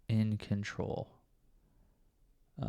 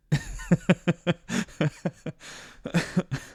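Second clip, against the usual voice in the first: breathy giggle; 8 semitones higher, 3 dB louder than usual. Real, not acted. Laughs at himself alone.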